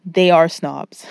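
slow, loud, each word hit; stress on they are snobs. Real, not acted. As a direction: press "They are snobs" slowly and firmly. Emphatic insistence.

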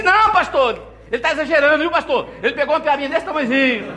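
high, fast, laughing wife tattling to the pastor; mocking wife. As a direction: squeal it out like a wife exposing her husband's fib. Falou assim.

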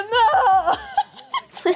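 high, wailed "no" through laughter; mock shock. Fan as the idols walk out, mock shock. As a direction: imitate overwhelmed disbelief, giggling. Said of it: "Y yo como así re sorprendida".

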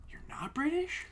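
high, soft disbelieving question; incredulous question. Retold or imitated. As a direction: act out quiet, squeaky disbelief. Retells disbelief at actor's accent.

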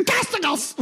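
very high, strained squawk; mock yell. Friend answering the woman, high mock yelling. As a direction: shriek it high like a panicked friend. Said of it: "he yelled testicles once".

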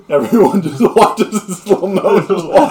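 high, loud words bursting through laughs; helpless laughter. Real, not acted. Game master describes feast watching little guest, laughing helplessly. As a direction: narrate while cracking up.

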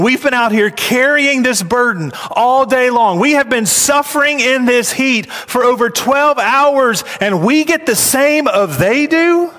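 high, mock-outraged protest; indignant complaint. Workers protesting to the landowner, indignant and high. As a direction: whine like cheated workers. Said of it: "We see indignation".

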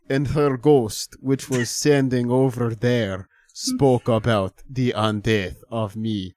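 put-on slow, heavy Swedish accent spoken word; thick Swedish accent. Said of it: "dude with a very thick Swedish accent just be like".

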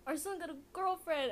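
excited mom. Retold or imitated. Mom telling dad about their son's girlfriend, excited. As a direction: announce it like a mother sharing big news. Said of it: "the mom was like".

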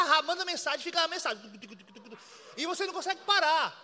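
ordinary talk, nothing marked

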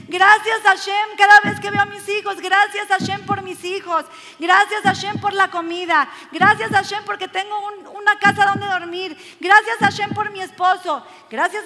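high, loud, driving list of thanks; fervent thanks. Acted. Believer thanking Hashem for each blessing, fervently. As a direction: pile up each thanks with rising fervour.

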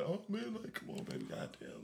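hushed, half-hearted imitation of pleading; mock begging. Showing friends how he used to beg women, hushed mock begging. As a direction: mumble a soft mock plea under your breath. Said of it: "begging for bitches".

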